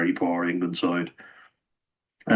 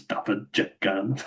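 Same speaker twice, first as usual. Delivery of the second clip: "Daffyd Jenkins" slow in a soft Welsh accent, softer, rising; 5 dB quieter, slower than usual. Shown as a demonstration. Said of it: "You have to say it with the accent".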